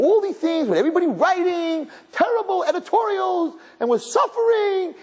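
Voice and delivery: high, sing-song sarcastic complaint; mocking whine. Rabbi mocking the editorials, high and sarcastic. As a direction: sneer at all the hand-wringing.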